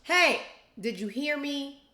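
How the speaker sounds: loud slow demand to be heard; sharp call-out. Retold or imitated. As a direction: snap them back to attention, loud and slow. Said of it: "I'll go".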